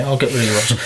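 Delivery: corrected tradesman voice. Retold or imitated. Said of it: "Oh, no, they don't talk like that".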